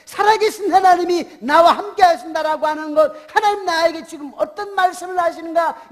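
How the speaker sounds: high, impassioned sermon exhortation; fervent preaching. Real, not acted. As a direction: preach it hard, urging the congregation. Preaches at full force.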